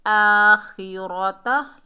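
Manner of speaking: slow, drawn-out tajweed chant; measured Quranic recitation. Shown as a demonstration. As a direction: recite each syllable long and steady. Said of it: "लाम को लाम से मिलाएंगे".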